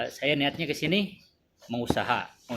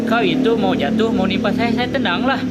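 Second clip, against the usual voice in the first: louder, higher insistent retort; retold exasperated protest; 6 semitones higher, 6 dB louder than usual. Shop owner defending himself to the angry neighbour, raised protest. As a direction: push back hard, it was falling on you. Speaker retells his own defence.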